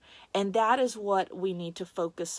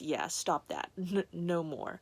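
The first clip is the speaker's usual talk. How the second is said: low, soft, firm telling-off; quietly stern; 3 semitones lower, 7 dB quieter than usual. Retold or imitated. Stern presence telling the speaker to stop, low and quiet. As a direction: put a gentle stop to it. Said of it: "I also felt this very stern".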